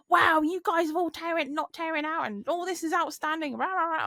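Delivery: imitating someone going off on one, high-pitched; high mocking tirade trailing into nonsense noises; mock rant. Weighbridge worker going off on one at a colleague, mock rant. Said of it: "she starts going off on one".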